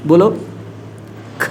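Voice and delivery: throaty ख; say kha. Shown as a demonstration. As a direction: breathe ख out from the throat. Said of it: "direct कंठी से हवा निकलता".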